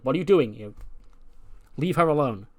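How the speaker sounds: loud mock protest; indignant objection. Tiffany catching Mina winking at Mariah, indignant objection. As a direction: play someone loudly warning off a rival. Acted objection to rival's flirting.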